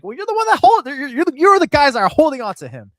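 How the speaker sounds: loud, high-pitched comeback; exasperated retort. Acted. Acted exasperated retort to coach.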